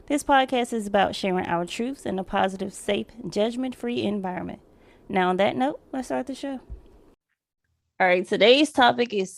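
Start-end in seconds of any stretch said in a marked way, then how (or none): none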